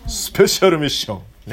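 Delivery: a light cartoonish hero voice on スペシャルミッション; anime hero voice. Shown as a demonstration. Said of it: "そんな漫画の声で言うなよ". (軽く漫画のヒーローっぽい声)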